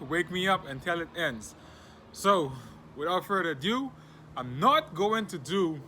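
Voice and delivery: high, loud, punchy host voice; energetic podcast welcome, loud and emphatic; show host intro. Acted. Hosts the show's intro.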